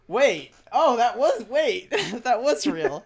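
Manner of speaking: loud, high, halting disbelief; startled realization. Retold or imitated. Viewer realizing the thumbnail was real, astonished. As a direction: stop and restart as it sinks in.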